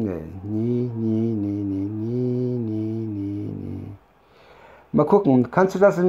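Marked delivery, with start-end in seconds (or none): none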